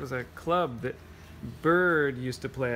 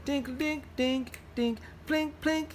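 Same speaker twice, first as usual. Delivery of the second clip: much higher, light piano plinks; 11 semitones higher than usual. Acted. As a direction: tinkle like high piano keys. Piano sound in story.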